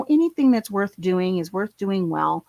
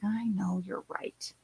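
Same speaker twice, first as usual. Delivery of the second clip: breathy whispered concession; whispered, defeated admission; resigned whisper; 11 dB quieter, slower than usual. Retold or imitated. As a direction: give in under your breath. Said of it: "it's like".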